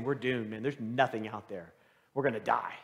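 low, resigned voice of defeat; understated gloom, low; doomed report. Acted. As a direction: give up hope, pitched well down. Spies bring back hopeless report.

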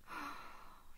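long, breathy exhale; soft sigh. Acted. Captor drawing Shadow close after the scare, soft sigh. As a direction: let out a quiet sigh.

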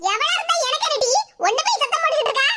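high-pitched, dismissive outburst; angry ranting. Acted. Mother brushing off her child's hushing, angry ranting. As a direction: rail at them, speeding up at the end. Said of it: "எம்மா, சத்தம் போடாதம்மா".

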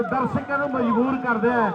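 usual speaking voice

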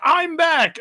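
high triumphant mock announcement; proud hero returning, hammed-up. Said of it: "he's like".

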